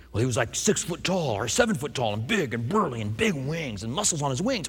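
low, soft voice of an awed witness; awed eyewitness. Acted. Friend describing the burly angel he saw, awed eyewitness.

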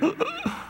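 sudden shocked intake of breath; high sharp gasp. Retold or imitated. Peter reacting to Jesus, a high shocked gasp.

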